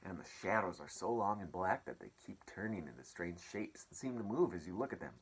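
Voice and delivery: usual speaking voice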